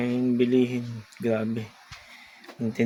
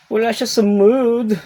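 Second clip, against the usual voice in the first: high, louder cooing to a pet; 10 semitones higher, 8 dB louder than usual. Real, not acted. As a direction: coo at the sulking cat. Voicing for the cat. (malambing na baby talk sa pusa)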